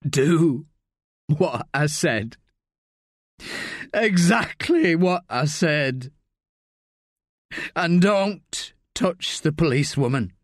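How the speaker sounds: slow, deliberate instruction; firm dying man. A narrator's reading. Rick giving Sally final orders, slow and firm. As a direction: spell out each order.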